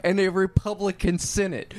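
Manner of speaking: real laughing exasperation; incredulous outburst. Real, not acted. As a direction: yell in disbelief at the absurd line.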